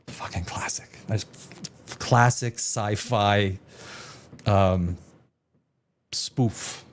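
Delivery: hushed aside. Real, not acted. Trailing aside.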